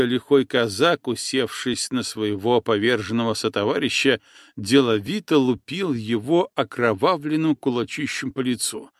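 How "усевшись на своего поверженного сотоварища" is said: ordinary delivery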